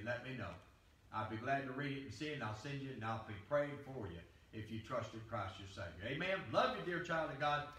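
tender invitation. Acted. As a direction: speak softly and tenderly to the listener.

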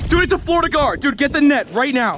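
high, rushed, urgent pleading; urgent excited calling. Real, not acted. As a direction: hurry your buddy to grab the net. Urging partner to net fish.

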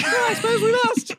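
high falsetto mock kid; child's voice. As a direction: give in with a small squeaky child voice. Child character replies.

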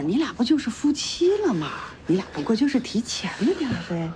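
ordinary talk, nothing marked

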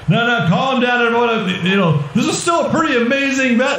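high-pitched announcer voice; flustered announcer. Acted. Announcer tries calming the rioting crowd, high and flustered. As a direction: placate an unruly crowd in a showman's high voice. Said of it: "And then it's going".

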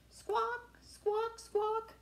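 high squawking parrot call. Acted. Teacher reading to kids, high parrot squawk.

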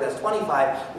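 normal talking voice

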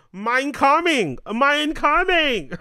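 put-on high, slow, loud German accent. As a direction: stretch it out in a hammy German accent. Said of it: "In my German accent".

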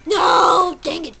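loud angry no, then dang it; frustrated shout. Real, not acted. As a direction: yell at the escaping ghost. Shouting at escaped ghost.

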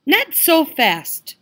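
high, slow clucking hen. Acted. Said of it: "clucked the little red hen".

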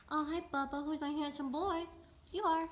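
high-pitched doting pet voice. Real, not acted. Baby-talking to the dog.

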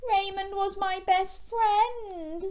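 high, slow mournful line; young shark mourning his friend, slow and high; sad child.

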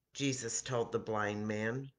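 plain reading voice

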